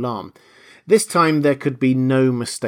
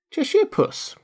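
timid. A narrator's reading. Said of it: "rather timidly".

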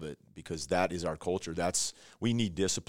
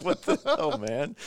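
high-pitched, laughing mock disbelief; retold exasperation, voice shooting up through laughter; incredulous exasperation; 5 dB louder, slower than usual. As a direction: blurt it out in comic dismay. Laughing, retells reaction to safety incidents.

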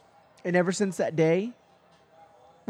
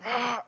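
higher, rasping mock growl; imitated bear growl; 6 semitones higher than usual. Telling how the mother bear growled, imitated bear growl. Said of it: "growling and".

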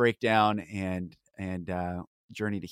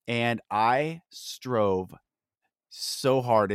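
"so" hit louder after a pause; slow build, leaning hard on "so"; emphasis on so; 3 dB louder, much slower than usual. Real, not acted. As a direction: stress the word "so", set apart by a pause. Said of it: "I want to emphasize the word so".

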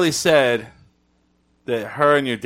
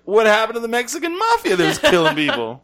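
mock indignant; 10 semitones higher than usual. Retold retort to parents.

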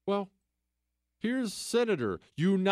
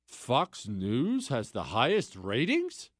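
mock disbelief; 6 semitones lower, slower than usual.

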